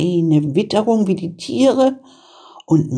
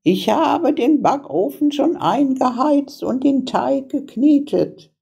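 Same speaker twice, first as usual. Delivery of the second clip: put-on high-pitched old witch; old witch with a plan, pitched well up; scheming old witch; 9 semitones higher than usual. A narrator's reading. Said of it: "sagte die Alte".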